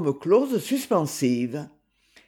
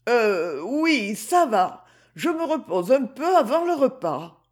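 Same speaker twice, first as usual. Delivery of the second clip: mildly plaintive, higher-pitched reply; a touch of weary self-pity, pitched up; doleful; 6 semitones higher than usual. A narrator's reading. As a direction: answer dolefully from bed, voice lifted. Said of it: "D'un ton dolent".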